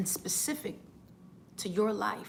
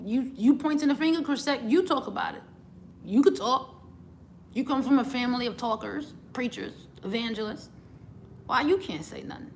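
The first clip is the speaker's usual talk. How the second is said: louder, higher, insistent challenge to oneself; self-scolding; 4 semitones higher, 5 dB louder than usual. Retold or imitated. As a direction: call yourself out, pushing hard on YOU. Scolding herself by name, retold.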